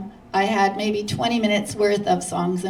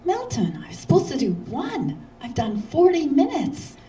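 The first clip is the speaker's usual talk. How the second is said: exasperated protest; 7 semitones higher than usual. Retold or imitated. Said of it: "so I said".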